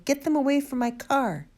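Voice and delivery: high-pitched, exasperated shout; shouted order. A narrator's reading. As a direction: shout it desperately at the sergeant. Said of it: "shouted Mister Hazel".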